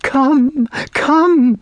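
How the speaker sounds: put-on high, desperate girlish cry; anguished high call; despairing plea. A narrator's reading. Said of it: "with such despair in her voice".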